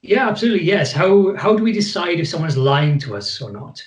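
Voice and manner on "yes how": ordinary talk, nothing marked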